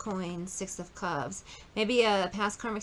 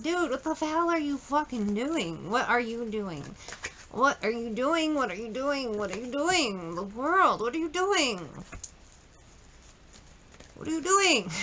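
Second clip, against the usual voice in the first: exasperated scolding; 4 semitones higher than usual. Retold or imitated. The divine scolding a person off their path, exasperated and rising. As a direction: keep asking what are you doing, building in exasperation. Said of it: "the Divine came in ... and was like".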